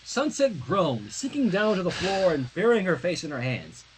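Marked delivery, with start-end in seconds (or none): none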